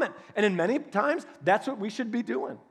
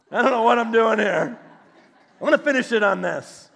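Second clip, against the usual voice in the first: ordinary talk, nothing marked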